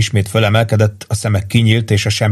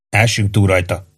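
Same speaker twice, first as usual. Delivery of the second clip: plain reading voice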